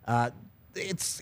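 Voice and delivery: ordinary delivery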